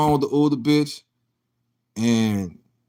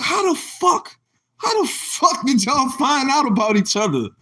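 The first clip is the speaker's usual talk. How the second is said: retold disbelief; 10 semitones higher than usual. He retells being caught out.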